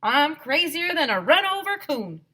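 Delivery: high loud country holler; shouted. A narrator's reading. Health teacher hollering about gold fever. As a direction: holler it wildly. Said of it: "shouted our health teacher".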